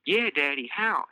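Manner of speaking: put-on high, sweet child voice asking for a cookie; little girl Elise. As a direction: play a small girl begging sweetly.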